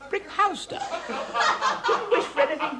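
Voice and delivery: high, laughing delight. Acted. Company delighted by the comic relief, laughing. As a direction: crow with laughter.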